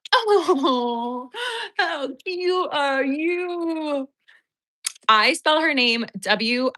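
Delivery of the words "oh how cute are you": adoring coo. Real, not acted. Owner telling her dog how cute she is, high adoring coo. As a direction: coo over a cute pet, stretching every word.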